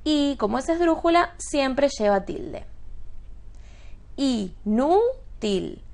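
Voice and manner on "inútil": "inútil" slowly split as i, nú, til; syllable by syllable. Shown as a demonstration. Teacher sounding out for students, "inútil" syllable by syllable. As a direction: draw out "inútil", stressing NÚ. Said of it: "Inútil es una palabra ¿de qué tipo?".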